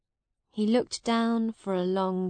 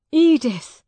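high, loud cry of her name; desperate appeal; 7 semitones higher, 11 dB louder than usual. Acted. Miles calls to the love who denies him, desperate. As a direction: call out to make her turn.

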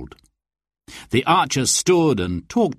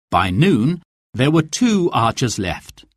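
usual speaking voice

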